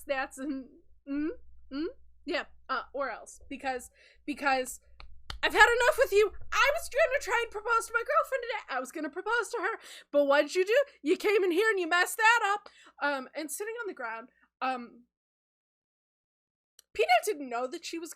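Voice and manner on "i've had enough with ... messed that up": loud, high, worked-up accusation; indignant rant, pitched up and loud; angry outburst. Acted. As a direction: have it out with them, loudly. Game master voices Sky's angry outburst.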